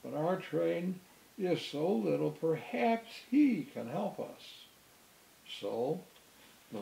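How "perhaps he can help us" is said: ordinary delivery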